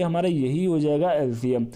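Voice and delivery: normal talking voice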